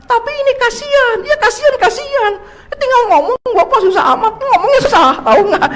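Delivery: high-pitched nagging wife voice, pitched well up. Retold or imitated. Preacher mimics his wife insisting he just talk to God, in a high nagging voice.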